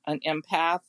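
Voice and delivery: normal talking voice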